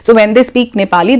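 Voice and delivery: ordinary talk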